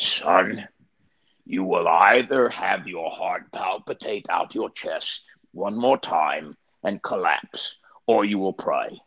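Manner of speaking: deliberate, imitated Cockney preacher; Eddie's Cockney accent. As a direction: issue a measured ultimatum in put-on Cockney. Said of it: "he had a Cockney accent".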